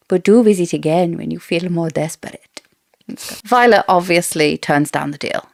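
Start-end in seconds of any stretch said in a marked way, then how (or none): 0.1-2.4 put-on Queen Marlies with a light South American accent, coolly inviting; a hint of a South American accent, smooth and regal. Host reading the queen's offer in a South American accent. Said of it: "I really like her accent for Queen Marlies".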